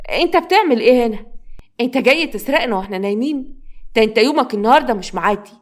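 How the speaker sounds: scolding, threatening stepmother; outraged accusation. Acted. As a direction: confront a thief you just caught. Stepmother catches boy at money.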